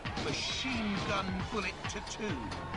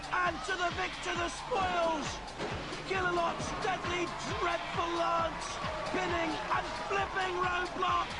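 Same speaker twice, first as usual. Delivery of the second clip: higher, excited sports commentary; live play-by-play; 11 semitones higher than usual. Real, not acted. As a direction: call the fight live and urgent.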